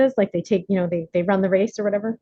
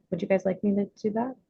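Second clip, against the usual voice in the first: ordinary talk, nothing marked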